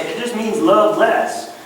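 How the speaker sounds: slow, placating imitation of other preachers; watering-down preacher. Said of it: "other preachers will, will kinda water that down".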